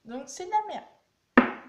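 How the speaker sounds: fed-up loud slam of a bad product; disgusted outburst. Real, not acted. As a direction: spit out the verdict on the useless polish. Exasperated verdict on useless product.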